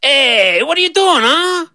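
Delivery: high cheeky goat voice; brash goat challenging the girl. Acted. Goat confronting the little girl, cheeky high voice.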